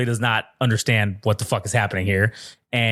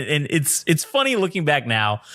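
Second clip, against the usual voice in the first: normal talking voice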